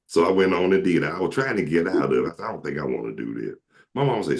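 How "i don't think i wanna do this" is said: mumbled reluctance. Retold or imitated. Trying to back out.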